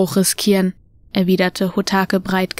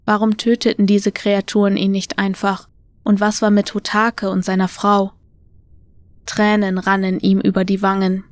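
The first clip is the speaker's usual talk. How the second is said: usual speaking voice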